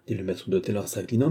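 ordinary delivery, nothing marked

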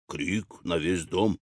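slow, low, soft echo; задумчивый повтор. A narrator's reading. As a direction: repeat it quietly, slowing down. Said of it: "повторил Кит". (медленный тихий повтор низким голосом)

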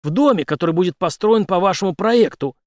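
decisive, forceful reply; resolute. A narrator's reading. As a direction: declare it firmly, pitched high and loud. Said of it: "решительно ответил Генри".

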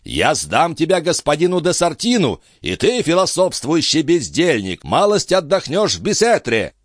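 put-on high-pitched threatening old baron; indignant high-voiced threat; growing fury. A narrator's reading. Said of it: "тем сильнее распалялся барон".

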